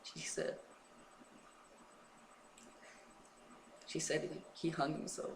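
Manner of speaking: high, quiet, struggling to get it out; choked with grief. Real, not acted. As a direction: voice thinning on hard news. Tells of friend's suicide, long pauses.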